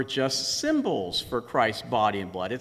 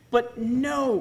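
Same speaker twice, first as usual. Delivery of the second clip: Luther retorting; 8 semitones higher than usual. Retold or imitated. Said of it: "replied Luther".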